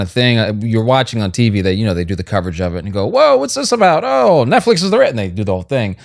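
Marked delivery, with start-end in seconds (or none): 3.1-5.1 high, louder mock-viewer exclamation; wowed viewer, pitched right up; acted surprise. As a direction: react with exaggerated surprise at a discovery. Acts out viewer's reaction to advert.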